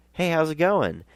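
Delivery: put-on high, eager hello in own accent; upbeat hello, voice lifted high; high-pitched friendly greeting. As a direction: call a greeting, pitched well up. His own greeting, before the accented reply.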